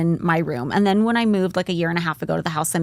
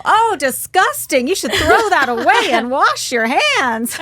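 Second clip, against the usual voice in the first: slow, higher mock-disgusted mom; 7 semitones higher, 4 dB louder, slower than usual. Mom tells kids to toss the G-string, slow mock disgust. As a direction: drag it out, grossed out.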